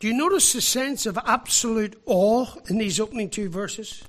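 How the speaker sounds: ordinary delivery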